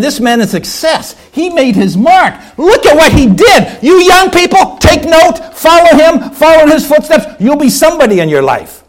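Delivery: high, loud funeral orator; grand eulogizing. Acted. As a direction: proclaim the praise as if to the whole town. Acted funeral eulogy by town leaders.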